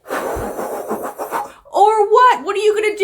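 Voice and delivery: high-pitched, scornful dare; taunting challenge. Acted. Younger woman daring grandma to act, scornful. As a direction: pitch it up and goad them.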